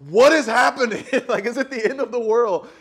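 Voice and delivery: high, loud alarmed cry with a laugh; mock panic at the storm; panicked friend. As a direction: play the panicked newcomer, half laughing. Friend panicking over Texas storm.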